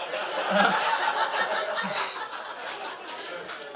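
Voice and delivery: long airy laugh high up; real laugh at own joke; high breathless laugh. Real, not acted. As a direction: let the laugh take over. Laughing at his punchline.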